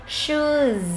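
"Shoes" drawn out on a long U. Shown as a demonstration. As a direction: say "Shoes" slowly for learners, vowel held. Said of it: "U dài này".

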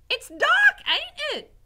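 very loud, high-pitched yell; shouting. A narrator's reading. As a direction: bellow it as an excited serving girl. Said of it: "she shouted to the rat".

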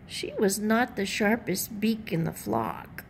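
ordinary delivery

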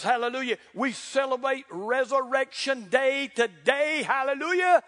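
high, loud, ringing preacher's cry; shouting the good news; preaching at full force. Real, not acted. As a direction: lift the church with every line. Preaching climax.

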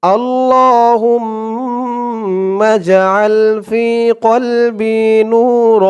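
slow, drawn-out Quranic recitation. Shown as a demonstration. Teacher intoning the opening to viewers, slow recitation. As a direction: intone each word slowly and devoutly. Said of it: "আমার সাথে বলুন".

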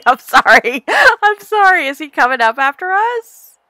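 normal talking voice

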